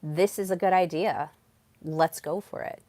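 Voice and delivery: steady statement, no questioning lift; speaking with power. Shown as a demonstration. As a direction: state it as settled and move straight on. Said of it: "instead of speaking with power".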